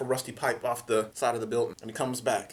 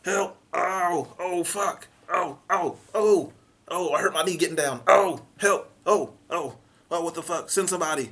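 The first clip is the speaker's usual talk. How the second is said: higher, louder hammed-up panic; panicked 911 caller; 5 semitones higher, 3 dB louder, slower than usual. Imagined dispatch call from behind a car, mock panic. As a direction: whimper and yelp for help in mock terror. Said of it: "imagining what this dispatch phone call".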